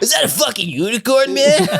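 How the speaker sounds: high voice through laughter; high laughing voice. Pretend. Player voicing a surfer turtle, high and laughing. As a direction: squeal it while laughing.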